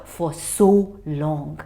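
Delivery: plain reading voice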